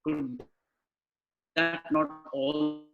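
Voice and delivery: normal talking voice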